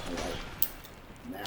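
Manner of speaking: ordinary talk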